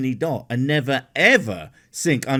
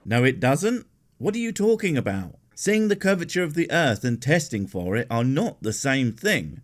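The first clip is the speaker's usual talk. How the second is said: ordinary talk, nothing marked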